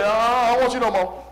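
loud, high sassy mock rejection; mocking the world. Preacher voicing the world's scornful brush-off, loud. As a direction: toss it off like the world dumping you.

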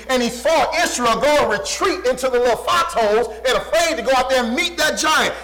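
ordinary talk, nothing marked

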